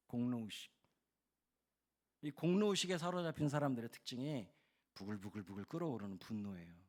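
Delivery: normal talking voice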